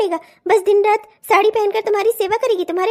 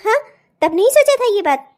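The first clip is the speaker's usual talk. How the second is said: ordinary talk, nothing marked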